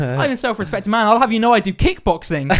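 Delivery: loud, high mock boasting threat; aggressive driver. Taxi driver threatens passenger with kickboxing, aggressively retold. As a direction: puff up like an offended driver warning someone off. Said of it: "starts getting a bit aggressive".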